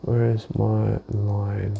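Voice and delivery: slow sing-song hunt for something; searching, drawn-out. Real, not acted. Trader to himself hunting for his line, drawn-out. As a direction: stretch each word out while searching.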